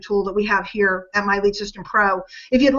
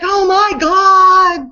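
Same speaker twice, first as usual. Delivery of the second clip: drawn-out, louder awed gasp of a phrase; awestruck; 7 semitones higher, 7 dB louder, much slower than usual. Retold or imitated. Everybody reacting to the big signing, slow loud awe. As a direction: stretch it slowly, stunned and impressed. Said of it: "everybody's like".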